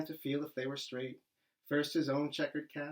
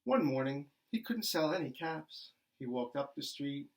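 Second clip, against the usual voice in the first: ordinary delivery, nothing marked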